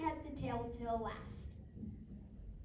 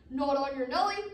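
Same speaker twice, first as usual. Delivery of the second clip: loud, emphatic wolf retort; booming storybook wolf reply; wolf's refusal; 10 dB louder than usual. Acted. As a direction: refuse the pig loudly. Wolf scornfully refuses the pig.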